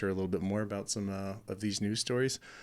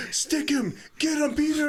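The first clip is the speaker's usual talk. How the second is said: high, urgent mock command; urgent egging-on; 3 dB louder than usual. Retelling the pig-killing movie scene, urgent high-pitched mock orders. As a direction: imitate a man urging on the kill. Urging a pig kill in film.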